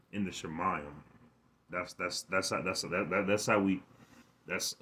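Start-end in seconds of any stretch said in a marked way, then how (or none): 1.7-4.8 stumbling over repeated words; excited stuttering. Real, not acted. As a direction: stutter as the thought outruns the mouth. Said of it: "I'm stuttering".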